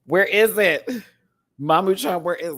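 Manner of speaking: high, loud calling; alarmed search. Real, not acted.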